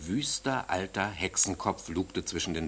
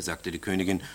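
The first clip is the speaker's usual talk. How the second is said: plain reading voice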